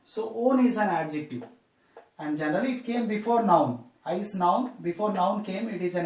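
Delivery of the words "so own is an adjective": ordinary talk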